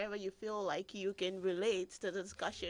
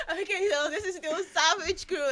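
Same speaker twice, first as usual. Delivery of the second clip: laughing; 7 semitones higher, 7 dB louder than usual. Real, not acted. Host relaunches show after laughter.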